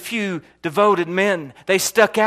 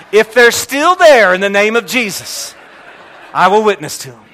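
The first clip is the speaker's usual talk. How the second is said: mock-bold vow; 4 semitones higher, 8 dB louder than usual. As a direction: declare it like a thundering preacher.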